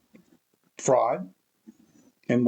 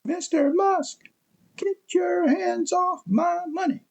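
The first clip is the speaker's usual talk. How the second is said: very high, slow mock yell that is no louder; much higher, drawn-out mock scream; mocking imitation of a protester's scream; slower than usual. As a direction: parody the protester squealing at Musk. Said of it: "screaming it almost loud of his voice".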